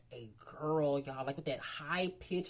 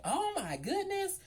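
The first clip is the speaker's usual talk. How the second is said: high, drawn-out oh my goodness; shocked gasp; 14 semitones higher, slower than usual. Retold or imitated. Retold reaction to the tearing.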